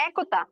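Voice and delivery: একতা begins with the open æ of এক; open e sound. Shown as a demonstration. Teacher contrasts একতা with একটি for students. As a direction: say একতা with the open æ vowel first. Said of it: "সামনেও এক আছে".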